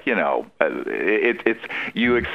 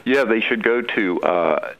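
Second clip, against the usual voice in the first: normal talking voice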